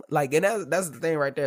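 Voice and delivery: normal talking voice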